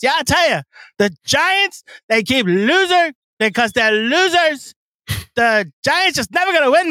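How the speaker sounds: high, ranting sports radio voice; Mad Dog impression. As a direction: rant like a fired-up radio host. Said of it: "spot on Mad Dog impression".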